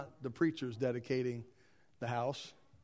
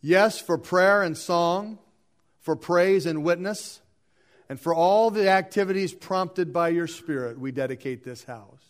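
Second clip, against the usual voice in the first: loud, declamatory litany, settling at the close; liturgical reading; 3 semitones higher, 9 dB louder than usual. Acted. Leader closes the dedication litany for the church, declamatory. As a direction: proclaim each petition firmly with pauses.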